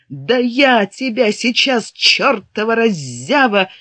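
slow furious yell, pitched high; berserk rage. Acted. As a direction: roar it out in fury. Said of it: "в ярости закричал он".

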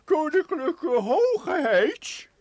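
slow, drawn-out courtly voice; bow and announce like a grand court marshal; pompous court marshal. Pretend. Professor playing the court marshal for the hidden girl, slow and pompous. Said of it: "En ik ben je hofmaarschalk".

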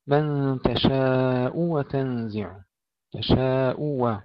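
"تشاء" drawn out with a clear hamza damma; slow tajweed demo. Shown as a demonstration. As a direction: stretch "من تشاء" slowly. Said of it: "ننتبه إلى ضم الهمزة".

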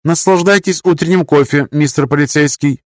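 high, polite machine voice; sweet robotic vending voice; old speech synthesizer. Acted. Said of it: "закончил синтезированный голос".

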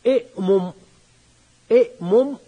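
إئمم said with both hamzas and a doubled م; teacher sounding out the form; underlying form. Shown as a demonstration. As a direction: sound out إئمم on the pattern of إصبع. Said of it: "ستقول".

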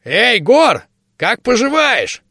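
high squeaky gnome voice. A narrator's reading. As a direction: squeak it out, small and shrill. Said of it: "окликнул писклявый голос".

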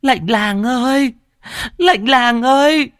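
high-pitched, drawn-out wailing cry; sudden scream. A narrator's reading. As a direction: scream it out very high, stretching the words. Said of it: "bỗng nhiên gào lên". (tiếng gào thét cao, kéo dài)

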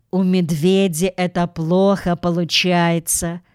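usual speaking voice

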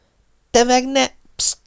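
shrieked hush. Acted. Pop stopping his parents' quarrel, sharp hushing shriek. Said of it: "süvöltött Pop".

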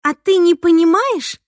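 high, loud accusing question; narrowed-eyed challenge. A narrator's reading. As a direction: needle him with it. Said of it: "сощурилась Снежана". (с вызовом, колко)

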